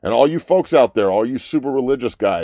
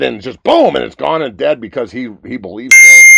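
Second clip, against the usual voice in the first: loud rant; 4 semitones higher, 11 dB louder than usual. Real, not acted. Ranting about preacher's claim.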